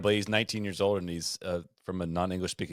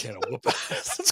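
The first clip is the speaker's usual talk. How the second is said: laughing; slower than usual. Retold or imitated. Punchline quote, laughing.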